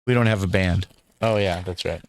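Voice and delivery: ordinary talk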